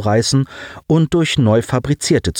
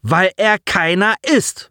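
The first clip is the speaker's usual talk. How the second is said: langsam, höher und scharf; fauchend; 9 semitones higher, slower than usual. A narrator's reading. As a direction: sie faucht ihn zur Verteidigung an. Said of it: "fauchte sie". (gespielte, verhaltene Wut)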